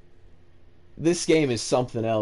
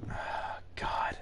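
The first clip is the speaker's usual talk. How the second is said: breathy scared whisper; 13 dB quieter than usual. Real, not acted. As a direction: hush it under your breath. Whispered alone in horror game.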